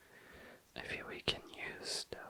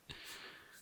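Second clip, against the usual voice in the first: whispered giggle; 9 dB quieter than usual. Real, not acted. Laughs at green-looking color.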